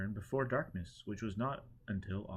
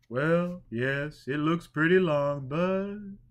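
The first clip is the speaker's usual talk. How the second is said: high, louder hesitant concession; drunkard conceding; 6 semitones higher, 8 dB louder, much slower than usual. A narrator's reading. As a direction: admit it reluctantly, high and loud.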